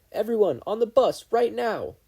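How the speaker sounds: urgent high loud command; yelling. Acted. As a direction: shout the students onto the bus. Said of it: "Thirteen yelled".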